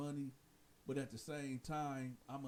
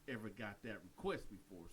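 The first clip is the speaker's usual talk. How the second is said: ordinary delivery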